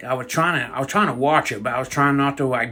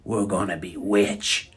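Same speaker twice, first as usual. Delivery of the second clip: scheming granny; 8 semitones lower, 6 dB quieter, slower than usual. Retold or imitated. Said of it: "She said".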